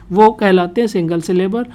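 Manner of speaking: ordinary talk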